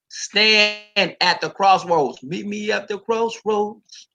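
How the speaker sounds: preacher reading scripture aloud with weight; dramatic scripture reading. Acted. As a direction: declaim it like a prophet.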